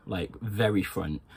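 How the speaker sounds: ordinary delivery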